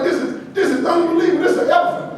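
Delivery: high, marvelling disbelief; mock amazement. Speaker to family at the circus, mock amazed. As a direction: gasp at the elephant like a kid.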